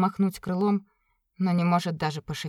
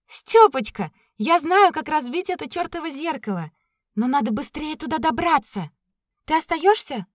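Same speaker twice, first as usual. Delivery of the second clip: eager; 7 semitones higher, 4 dB louder than usual. Acted. Katya eager to go.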